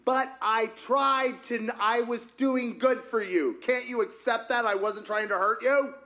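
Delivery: pleading, defensive self-justification; acted defensiveness. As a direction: protest your good intentions as if wrongly accused.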